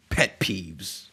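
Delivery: normal talking voice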